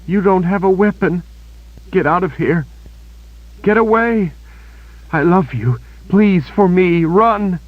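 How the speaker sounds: wounded Kaelin urging Richard to flee; desperate young woman. A narrator's reading. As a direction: beg him to run, hurt and frightened.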